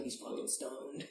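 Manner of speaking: hushed gossip to a friend; conspiratorial whisper; whispered aside. Retold or imitated. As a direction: whisper it under your breath. Friends whisper about stoned guy.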